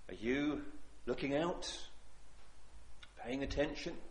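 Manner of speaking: normal talking voice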